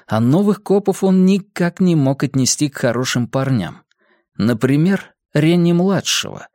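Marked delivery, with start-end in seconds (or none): none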